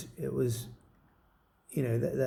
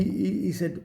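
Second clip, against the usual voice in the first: usual speaking voice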